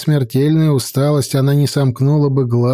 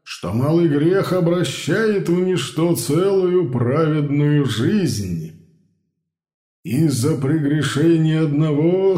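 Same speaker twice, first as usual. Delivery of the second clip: biblical prophet; 4 dB quieter than usual. A narrator's reading. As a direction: intone it like an old prophet. Scripture-like sermon passage.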